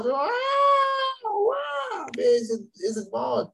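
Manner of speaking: long high drawn-out wail; slow high imitated crying wail; imitated child wailing. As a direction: mimic a small child bursting into tears. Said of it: "מתחיל לבכות".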